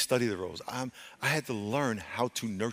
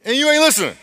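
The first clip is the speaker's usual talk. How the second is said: high, loud burst of rebuke; confronting shout; 13 dB louder, faster than usual. Real, not acted. Preacher telling congregation they ignore God's calls, confronting shout. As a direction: throw it at the congregation.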